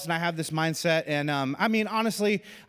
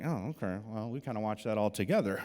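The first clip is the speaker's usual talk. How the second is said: sheepish; 7 semitones lower, 8 dB quieter than usual. Retold or imitated. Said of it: "And you're like".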